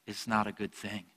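normal talking voice

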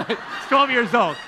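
loud, high, laughing amazement; tickled, laughing out the words. Real, not acted. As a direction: say it slowly through a real laugh. Laughs at the boy's blessing.

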